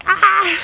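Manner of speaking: sudden high yelp of frustration; a quick exasperated "ah!" jumping up high; short exasperated yelp; frustrated cry. Real, not acted. Exclaiming frustration mid-story.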